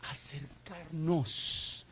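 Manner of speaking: breathy slow whisper; whispered, drawn out. Acted. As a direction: hush it like a secret. Preacher whispers the answer.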